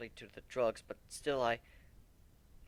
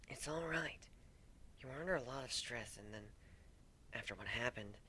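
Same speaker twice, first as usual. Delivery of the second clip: much softer, near whispered comfort; hushed comforting friend; soft reassurance; 13 dB quieter than usual. Acted. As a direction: soothe him gently. Friend soothing in hush.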